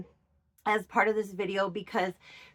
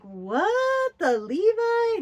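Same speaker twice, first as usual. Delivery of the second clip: high, louder gasp of disbelief; shocked disbelief; 12 semitones higher, 4 dB louder, slower than usual. Retold or imitated. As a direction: act out the stunned double take. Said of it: "Yes, I was like".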